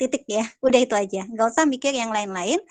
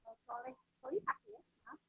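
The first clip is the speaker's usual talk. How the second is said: very faint, far from the mic; barely audible; 15 dB quieter than usual. Real, not acted. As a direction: voice drifting away from the mic. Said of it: "suaranya terlalu kecil".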